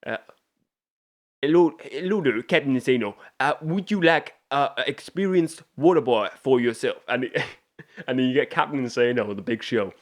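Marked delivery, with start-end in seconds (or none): 1.4-7.0 higher, goofy put-on caller voice; hesitant comic character voice, pitched up; Bobby Boucher. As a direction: imitate a silly-accented water boy phoning in. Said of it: "and he's saying".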